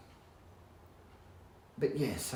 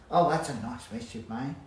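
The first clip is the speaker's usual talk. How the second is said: louder, annoyed tone at a mess-maker; mock angry scolding; 8 dB louder than usual. Shown as a demonstration. Scolding someone who made a mess, louder and cross. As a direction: scold them for the mess, half in jest. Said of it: "you're angry".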